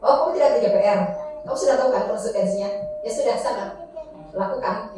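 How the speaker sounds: level, even questioning; teacher flatly pointing a pupil to the rule; flat monitoring teacher. Shown as a demonstration. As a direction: read out the rule with no feeling. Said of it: "tanya dengan surat datar".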